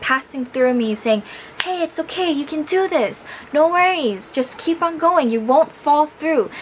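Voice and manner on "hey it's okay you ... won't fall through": high, light cheering-on voice; imitated reassurance. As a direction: imitate friendly passers-by urging someone on. Voices passers-by cheering her on.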